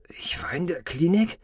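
half-whispered, high puzzled question; hushed puzzlement. Acted. Patient just out of coma, hushed puzzlement. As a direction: ask it under your breath.

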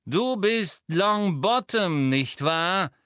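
mock the boy, stretching his name; jeering. A narrator's reading. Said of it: "höhnte Lucius Malfoy". (höhnisch und langsam, höher und lauter)

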